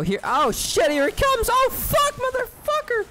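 high, loud panicked yells; alarmed yelling. Real, not acted. Reacting to something coming at him, high panicked yells. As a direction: shriek as it hits you.

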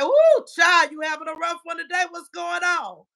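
high, fast, playful check-in voice; teasing concerned friend. Retold or imitated. Telling viewers how to call her out, high playful teasing. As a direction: act out the honest friend's teasing question. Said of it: "simply say".

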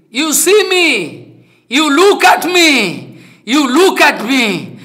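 high, slow, emphatic calling out; retold urgent proclamation. Dying guru calling his disciples to witness, urgent slow proclamation. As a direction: proclaim each phrase slowly, pitched high.

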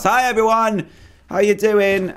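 high, bouncy mock-cheerful hello; sing-song hi to the villagers; playful greeting. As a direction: barge in like an awkward guest. Greeting villagers inside their house.

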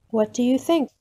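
ordinary delivery

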